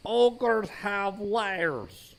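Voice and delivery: very slow, drawn-out mock Scottish ogre; Shrek Scottish accent. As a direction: stretch each word like a bad Shrek. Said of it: "my attempts at Shrek's accent".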